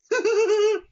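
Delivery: loud shrill creepy cackle; high-pitched laugh. Retold or imitated. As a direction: let out a squealing unsettling laugh. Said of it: "it sounded like he was kinda like".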